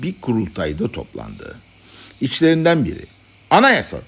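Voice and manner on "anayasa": high, sudden cry; shouted call. A narrator's reading. One madman to the congress, shouting out. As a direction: call it out to the assembly. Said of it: "diye bağırdı".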